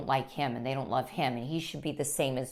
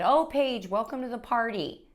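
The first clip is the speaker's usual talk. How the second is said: mocking husband; 9 semitones higher, 3 dB louder than usual. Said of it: "And he said".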